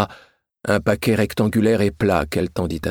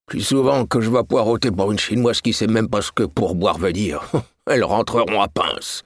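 grumbling coachman. A narrator's reading. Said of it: "marmonna-t-il".

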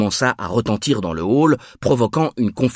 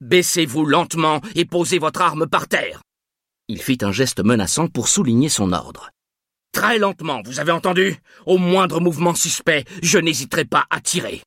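higher, tense commanding voice; commanding order; 6 semitones higher than usual. A narrator's reading. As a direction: hold the gun on him and command. Guard orders armed man down.

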